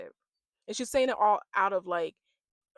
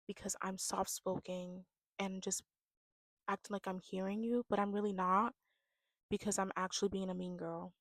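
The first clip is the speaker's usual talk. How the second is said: hushed, falsely gentle victim voice; mock soft-spoken; 9 dB quieter than usual. Posing as the victim while being mean, soft-spoken. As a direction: play the soft talker, voice kept well down. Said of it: "because they talk so soft".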